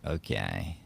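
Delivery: quiet plan. Retold or imitated. Retold fight thought.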